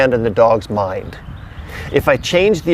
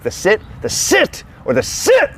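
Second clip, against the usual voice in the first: each sit said on a new, much higher pitch; varied pitches; 8 semitones higher than usual. Shown as a demonstration. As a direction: show three different ways of saying sit. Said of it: "three intonations are completely different".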